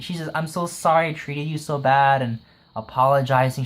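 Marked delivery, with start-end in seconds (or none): none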